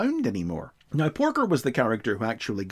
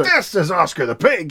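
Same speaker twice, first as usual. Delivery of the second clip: gruff chief; 7 semitones higher, 5 dB louder than usual. Retold or imitated. Chief shows mugshot angrily.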